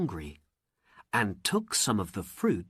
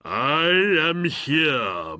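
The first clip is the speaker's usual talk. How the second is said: slow, loud, grand genie voice; booming genie; 5 semitones higher, 6 dB louder, slower than usual. Acted. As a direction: announce yourself like a mighty spirit. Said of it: "the genie cried".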